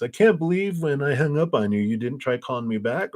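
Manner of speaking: high, whiny guilt trip; mock sadsies. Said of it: "start over with the sadsies".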